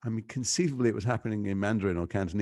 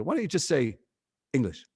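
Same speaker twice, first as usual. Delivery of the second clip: mocking crew's retort; 6 semitones higher, 3 dB louder than usual. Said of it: "they go".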